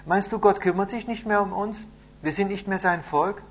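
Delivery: put-on wondering boy. A narrator's reading. Boy asks grandfather whether God still cares, high and questioning. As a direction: ask high, like a troubled child.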